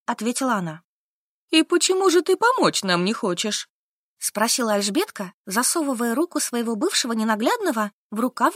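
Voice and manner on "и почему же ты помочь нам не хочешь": higher, a second woman's voice; ask, a bit hurt; Alzhbetka asking. Acted. Alzhbetka asks.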